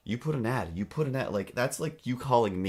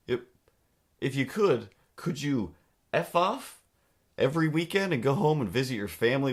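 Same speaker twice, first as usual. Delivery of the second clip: mock outrage; 6 semitones higher, 3 dB louder than usual. Real, not acted. Mocking the landlord's demand.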